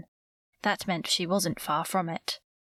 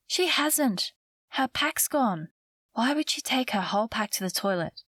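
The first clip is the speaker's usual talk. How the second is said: higher-pitched, worried contradiction; alarmed protest; 4 semitones higher than usual. A narrator's reading. Girl insisting her friend's pack is gone, alarmed.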